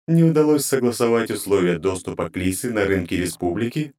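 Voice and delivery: high, grating divine voice; cold goddess interrogating her servants; inhuman goddess voice. Acted. Goddess voice continues.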